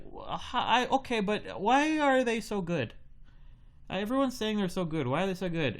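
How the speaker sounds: put-on high, flustered stammering boy; the boy pressing the baker, pitched high; flustered kid. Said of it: "And he's like".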